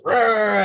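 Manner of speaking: mock frustrated "rah" roar; angry growl. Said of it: "I'd get all angry and mad".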